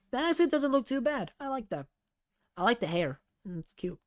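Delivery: ordinary delivery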